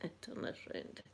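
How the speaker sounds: hushed unvoiced whisper; tender whisper over a family photo. Real, not acted. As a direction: barely breathe the words, moved. Tearful whisper showing dad's photo.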